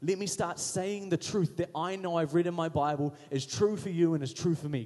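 usual speaking voice

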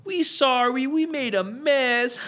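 put-on high, loud, very slow baby-talk apology. Old lady baby-talking to the fast food staff. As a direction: coo the apology like speaking to a toddler. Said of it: "they baby talk, like, fast food employees".

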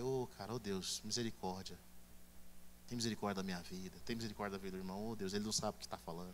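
soft, fast pleading prayer under the breath; muttered prayer. Acted. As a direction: rush a hushed plea for mercy. Acted quick pleading prayer. (oração baixa e apressada)

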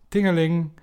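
high, loud sing-song tingeling like a shop bell; imitating a bell. Snack owner [name] mimics the broken door bell, high and loud. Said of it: "die de bel van de snack maar nadeed".

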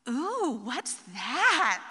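a hint of a curious child's voice; lightly voiced little son. Son catching mom with his present, lightly curious child voice. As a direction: ask it like a small boy. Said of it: "this little voice behind me".

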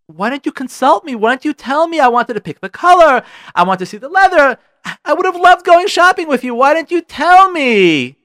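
high, loud, upset complaint; furious wife, acted out at full pitch; livid wife. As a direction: berate him for not asking. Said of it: "livid. The woman's livid".

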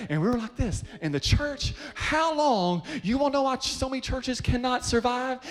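a preacher climbing to his point; preaching, rising. Real, not acted. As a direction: press the question home. Preacher rising to a high pitch.

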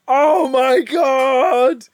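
overjoyed shout at the scene; ecstatic cry. Real, not acted. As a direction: cry out in delight. Reliving thrilling scene.

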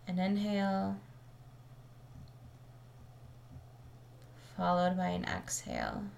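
calm, measured meditation voice with long pauses; settled, even delivery, leaving space between phrases; guided meditation. Acted. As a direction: guide listeners gently into the breath, unhurried.

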